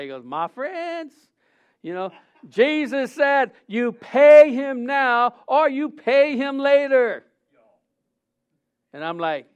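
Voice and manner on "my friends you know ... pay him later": put-on Southern preacher's accent, rising to a loud, slow shout. Preacher imitating Brother Fred's Southern accent, high and shouted. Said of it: "I can't really do a Southern accent. I'll try".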